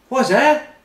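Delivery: high, squeaky put-on voice; shrill mock falsetto of a confused diner; mock high squeak. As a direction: squeak it out like a baffled kid. Neil voiced in retold anecdote.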